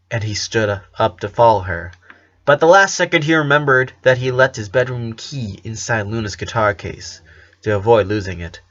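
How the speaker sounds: ordinary delivery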